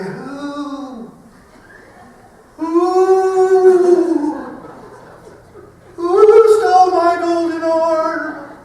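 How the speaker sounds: high, loud, drawn-out spooky ghost wail; haunt the woods, howl it slowly; moaning ghost. Retold or imitated. The dad playing the ghost in the woods, high slow wail.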